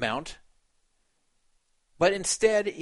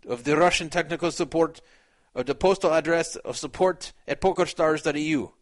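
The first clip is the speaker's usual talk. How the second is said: put-on amateur Russian accent, lightly done. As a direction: read the forum post in a half-hearted Russian accent. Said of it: "even though I'm putting this accent in".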